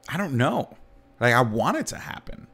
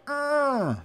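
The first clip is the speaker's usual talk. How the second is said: high strained grunt like pushing; straining grunt. Retold or imitated. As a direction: push the sound out hard. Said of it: "that was a sound whenever I got a kill".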